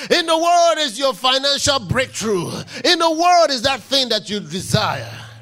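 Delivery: loud, rising sermon shout, each line hammered; full-force preaching. Real, not acted. Preacher rallying the congregation about the word, shouting. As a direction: build the congregation up with every "word".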